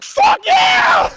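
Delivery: high, loud yell of joy; a loud, high whoop of victory; inner triumphant shout. Retold or imitated. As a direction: let it rip like you just won. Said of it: "On the inside, I was like".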